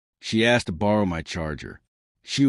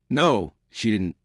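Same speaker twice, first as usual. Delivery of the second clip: usual speaking voice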